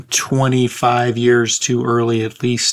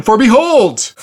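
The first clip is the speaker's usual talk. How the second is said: booming behold; 11 semitones higher, 8 dB louder, slower than usual. Acted. Dramatic scripture reading.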